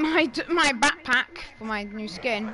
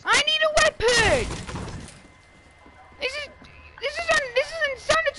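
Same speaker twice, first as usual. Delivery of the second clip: frantic high yell; 14 semitones higher, faster than usual. Real, not acted. Frantic demand.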